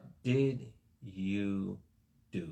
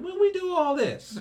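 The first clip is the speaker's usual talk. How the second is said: squeaky high put-on voice, much louder; baffled goats asking; 9 dB louder than usual. Preacher voicing the goats asking Jesus, squeaky and loud. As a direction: play the goats as shrill, clueless complainers. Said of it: "And they said".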